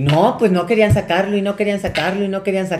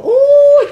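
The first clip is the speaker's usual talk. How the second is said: long, high, loud "uuuh"; mock outraged "uuh"; 10 dB louder than usual. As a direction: hoot it in exaggerated alarm. Mother's outraged reaction, acted out. («uuh» agudo de indignación imitada)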